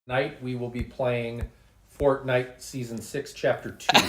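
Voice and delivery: booming podcast host intro; radio announcer voice. Pretend.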